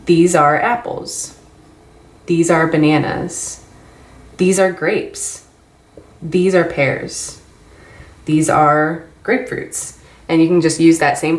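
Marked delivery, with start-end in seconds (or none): 0.1-10.0 slow and clear, word by word; slow teaching repetition. Shown as a demonstration. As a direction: spell it out slowly for a beginner. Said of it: "pick those ten vocabulary words and then say".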